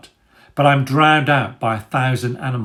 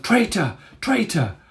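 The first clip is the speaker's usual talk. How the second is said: high squealing chant from many rats; 9 semitones higher than usual. A narrator's reading. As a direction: squeal the accusation shrilly. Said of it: "There are squeals of".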